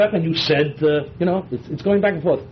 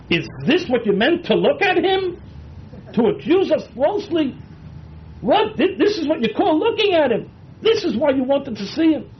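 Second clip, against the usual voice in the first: accusing; 9 semitones higher than usual. Acted. Midrash voices brothers' accusation.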